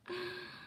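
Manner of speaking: sudden high gasp of surprise; sharp high gasp. Real, not acted. Reacting to a viewer's comment with a gasp. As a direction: gasp in delight.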